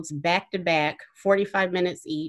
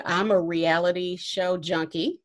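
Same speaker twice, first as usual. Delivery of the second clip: ordinary talk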